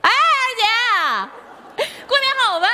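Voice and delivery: very high, stretched-out calling; effusive shouted phone hello; long-distance holler. Acted. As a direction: holler a greeting as if they are far away. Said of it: "离得远就得喊呐".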